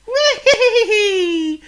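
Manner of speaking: a bird thrilled to fly; delighted squeal; joyful bird. Acted. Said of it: "he cried".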